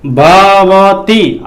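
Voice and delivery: भवती drawn out slowly; slow sounding out. Shown as a demonstration. Teacher sounding out भवती slowly for students. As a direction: stretch भवती so the अव sound stands out. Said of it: "इसमें किसकी ध्वनि आ रही है?".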